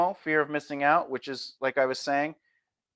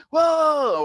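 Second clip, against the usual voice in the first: much higher, louder fake amazement; mock hype hook; 11 semitones higher, 5 dB louder than usual. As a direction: oversell the wow. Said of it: "you gotta jump into".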